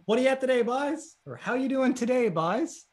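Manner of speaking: friendly-term "buys", vowel as in "pie"; dialect example greeting. Shown as a demonstration. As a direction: lift the greeting and end on "buys" with an "eye" vowel. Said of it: "can you use it in a sentence?".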